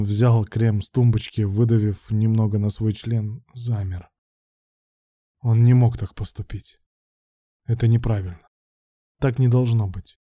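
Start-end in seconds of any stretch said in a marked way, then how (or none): none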